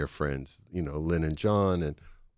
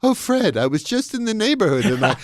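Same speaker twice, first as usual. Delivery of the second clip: much higher, louder sing-song impersonation; imitating Joanne Worley; 13 semitones higher, 5 dB louder than usual. Arriving at Fred's party, in a high, hammed-up guest voice. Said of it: "and she says".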